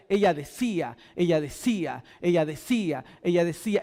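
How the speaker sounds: voicing the constant saying; continuous repeating. Shown as a demonstration. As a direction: repeat ella decía, ella decía low and steady. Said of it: "es un decir continuo".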